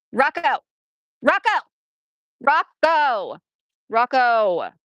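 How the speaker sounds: "Rocco!" shouted to the dog across the house; calling the dog. Real, not acted. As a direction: call out loudly for the dog, repeated.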